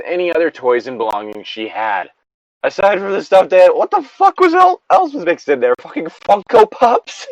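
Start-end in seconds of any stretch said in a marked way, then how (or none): 2.6-7.3 2 high, loud disbelieving outburst; 3 rant at the mess in disgust; 1 outraged rant. Real, not acted. Outraged reaction while reading story.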